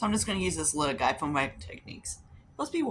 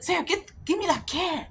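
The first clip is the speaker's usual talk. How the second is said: much higher put-on voice for the neighbor; mock the neighbor, pitched way up; imitating neighbor; 14 semitones higher than usual. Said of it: "He was like".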